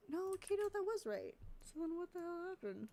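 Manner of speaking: high, baffled questioning; confused surprise. Real, not acted. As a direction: puzzle over it, pitch way up. Confused by her edit.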